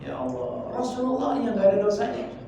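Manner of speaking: loud, urging sermon voice, drawn out on the prayer words; fiery preaching. Acted. Preacher urging the congregation to repeat istighfar, loud and fervent. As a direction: preach it out to the whole room.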